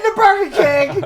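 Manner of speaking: high, loud laugh-cry on the words; laughing till it squeaks. Real, not acted. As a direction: break up laughing. Laughing hard at the story.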